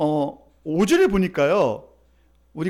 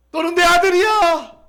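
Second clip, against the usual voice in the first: acted joyful cry; 8 semitones higher, 8 dB louder than usual. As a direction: cry out in overjoyed welcome. Father claims his returned son. (기쁨에 차서 길게 외치는 소리)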